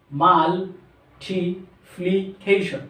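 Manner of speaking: "মাল্টিপ্লিকেশন" very slowly, a pause between syllables; teacher sounding out "মাল্টিপ্লিকেশন" piece by piece; syllable-by-syllable pronunciation. Shown as a demonstration. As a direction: break "মাল্টিপ্লিকেশন" into separate, drawn-out syllables. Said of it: "এইভাবে যদি আমরা জেনে উচ্চারণ করি".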